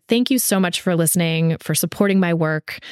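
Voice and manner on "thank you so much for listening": normal talking voice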